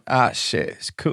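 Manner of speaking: slurred, put-on English lad speech; mock a private-school English lad acting cool; posh English boy faking street accent. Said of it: "a lot of English boys".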